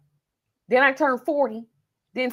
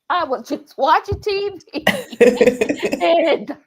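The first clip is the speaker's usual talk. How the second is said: laughing mock answer; 5 semitones higher, 5 dB louder than usual. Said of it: "Because I would be like".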